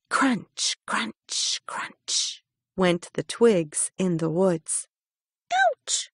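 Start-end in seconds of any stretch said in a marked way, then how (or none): 5.5-6.1 a sudden, very high squeaked ouch; high squeaky ouch. A narrator's reading. Stick insect squeaks at the bumping hare. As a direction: yelp it high like a tiny bug. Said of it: "a voice cried".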